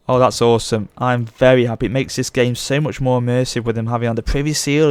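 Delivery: normal talking voice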